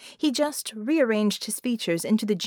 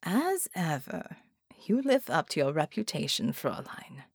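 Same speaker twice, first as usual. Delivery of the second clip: bow to her and mean it; quiet, hushed sincerity from a German gentleman; German gentleman, sincere; 4 semitones lower, 5 dB quieter than usual. Acted. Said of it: "without a trace of mockery".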